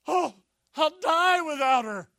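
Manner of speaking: loud, high cry of hammed-up heartbreak; mock despair at full volume; melodramatic lovesick wail. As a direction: wail it like a lovestruck drama king. Acted lovesick waverer, one extreme.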